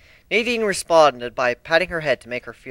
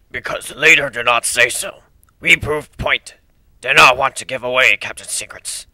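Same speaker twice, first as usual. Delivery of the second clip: gravelly dog voice; 6 dB louder than usual. A narrator's reading. Growl explains.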